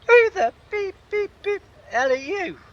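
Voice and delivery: farmer bellowing it high and loud; angry shout. Retold or imitated. Farmer catching a stranger ploughing his field, furious shout. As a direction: yell it at the stranger on your land.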